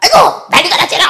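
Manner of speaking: high-pitched, fussing outcry; mock shriek, far above own pitch. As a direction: imitate a woman yelping in uproar. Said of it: "소리를 빽 지르면서".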